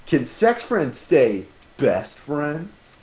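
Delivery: slow, drawn-out tagline read; slow trailer voice. Shown as a demonstration. Reading tagline aloud.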